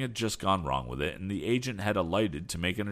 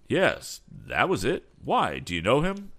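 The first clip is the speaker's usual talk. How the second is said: louder, higher-pitched surprised reply; some surprise; 6 semitones higher, 5 dB louder than usual. A narrator's reading. Mister Berg learning Tom knows the young man, surprised. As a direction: react with lively surprise to the name. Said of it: "asked Mister Berg in some surprise".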